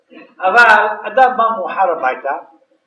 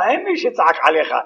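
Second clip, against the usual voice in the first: normal talking voice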